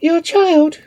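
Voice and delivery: very high, loud question; shrill disbelief; astonished cry. A narrator's reading. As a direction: cry out in shock. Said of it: "cried Rosabella".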